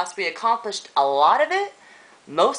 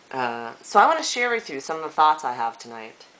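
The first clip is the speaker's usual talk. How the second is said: ordinary talk, nothing marked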